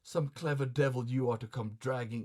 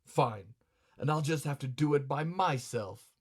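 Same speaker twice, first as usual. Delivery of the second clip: indignant retort. Acted. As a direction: throw it back with a firm punch. Kinzo retorts, echoing her words.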